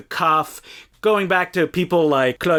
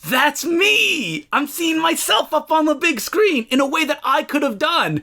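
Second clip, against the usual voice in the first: excited; 10 semitones higher than usual. Retold or imitated. Young viewer excited by film.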